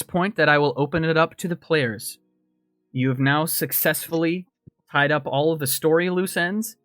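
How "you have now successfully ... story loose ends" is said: normal talking voice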